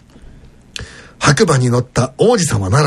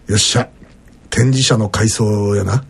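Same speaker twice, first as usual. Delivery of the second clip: lower, slow put-on Kansai accent, a bit phoney; fake Kansai dialect; 7 semitones lower than usual. Host voicing the company president in a phoney Kansai accent. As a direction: play the old boss in a cheesy fake Kansai accent. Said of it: "嘘くさい関西弁で".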